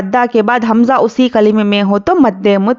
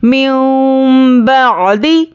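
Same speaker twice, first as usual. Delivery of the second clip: slow, melodic tajweed recitation; measured Quranic chant; much slower than usual. Shown as a demonstration. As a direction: chant it slowly like a qari. Recites verse fragment.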